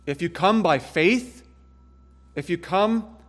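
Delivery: fervent altar call, climbing high; impassioned preaching. Acted. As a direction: preach it to the back row. Preaching the gospel appeal.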